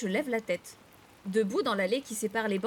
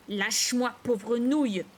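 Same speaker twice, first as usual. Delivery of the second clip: angry, forceful retort; raging; 4 dB louder, slower than usual. A narrator's reading. Sister pulling free of brother's grip, raging. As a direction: lash out to break free. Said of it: "rage Violette en se dégageant".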